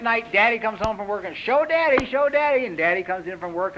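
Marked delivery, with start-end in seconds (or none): 1.4-2.7 high, singsong parent coaxing. Acted. Mother showing Farquhar off to Daddy, high coaxing. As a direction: eagerly prompt a toddler to perform.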